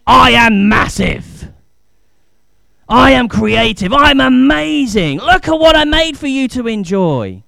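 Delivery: loud, slow booming voice of God; God declaring how great He is; grand declaration. Acted. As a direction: proclaim it huge and amazing.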